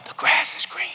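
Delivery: soft unvoiced whisper; teacher's whispered test line. Shown as a demonstration. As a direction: breathe the words like a hearing test. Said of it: "she'd whisper things like".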